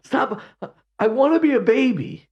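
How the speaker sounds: Titus screaming on live TV, hammed-up high wail; mock scream. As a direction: scream it like a theatrical diva. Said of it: "he screams out".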